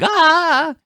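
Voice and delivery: high yelp of terror; sudden high scream; mock terrified yell. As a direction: shriek like someone fleeing in panic. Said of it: "just yelling".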